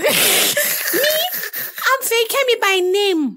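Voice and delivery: high, very slow drawn-out voice; dopey cartoon bellow. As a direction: stretch it out in a silly high voice. Voicing woman in meet cute.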